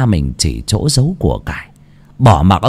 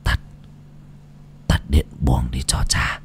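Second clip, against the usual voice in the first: a faint dying rasp, much softer; thều thào yếu ớt; 8 semitones lower, 9 dB quieter than usual. Acted. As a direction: barely get the words out. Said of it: "thều thào". (thều thào như người hấp hối)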